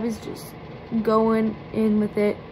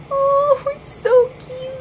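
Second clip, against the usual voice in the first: squealing cutesy voice; cutesy coo; 5 dB louder, slower than usual. Real, not acted. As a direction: coo at a tiny cute thing. Cooing over tiny palette.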